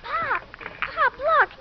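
high excited call to dad; girl's thrilled call. Acted. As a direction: squeal it out, amazed. Girl excited by trick shot.

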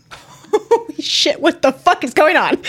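high, loud, laughing disbelief; astonished outburst. Real, not acted. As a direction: exclaim it in amused shock. Player astonished at the lucky turn.

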